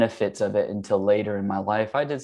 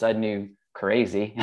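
usual speaking voice

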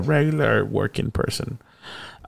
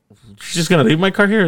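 disbelief; 9 semitones higher than usual. Retold or imitated. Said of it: "I was like".

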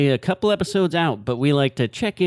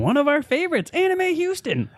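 excited high voice; 14 semitones higher than usual. Acted. Gushing about the convention.

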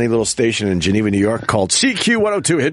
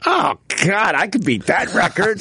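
higher-pitched eager boast; retold bravado; 6 semitones higher than usual. DJ daring himself during a long song, retold bravado. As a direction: replay your own sudden idea, pitched up. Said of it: "and then I went".

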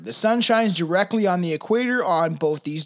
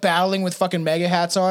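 usual speaking voice

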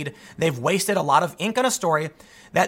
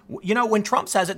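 ordinary talk, nothing marked

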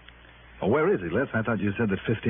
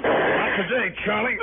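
commanding shout; 12 semitones higher, slower than usual. Acted. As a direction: shout him down before he shoots. Hero stops armed man.

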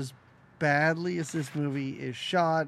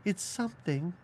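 soft mock British accent; 3 semitones higher, 7 dB quieter than usual. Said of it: "somehow turns British".

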